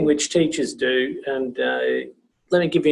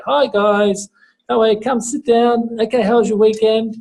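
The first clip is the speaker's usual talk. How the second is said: high, chatty welcome to the class; talking as to girls; 7 semitones higher than usual. Shown as a demonstration. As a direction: welcome them in a raised, friendly lilt. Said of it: "that's how I'd normally talk to girls".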